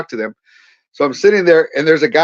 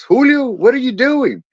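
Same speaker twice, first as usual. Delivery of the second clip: much higher, drawn-out surprised question; astonished; 9 semitones higher than usual. Retold or imitated. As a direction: imitate a friend amazed to bump into you. Friend surprised to spot him outside.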